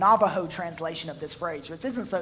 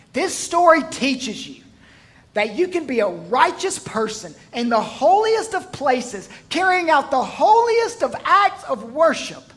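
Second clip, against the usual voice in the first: higher, louder pulpit push; full-force preaching; 6 semitones higher, 7 dB louder than usual. Real, not acted. Preacher warning the congregation, loud and high. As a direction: drive the point home.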